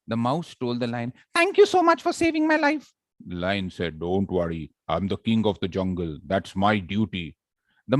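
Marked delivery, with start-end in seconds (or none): 1.3-2.9 very high, squeaky grateful mouse; tiny squeaky mouse thanking; shrill mouse voice. Acted. Mouse thanks the lion for saving it, squeaky. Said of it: "It was shrill". 4.0-7.4 lion. Acted. Lion in fable.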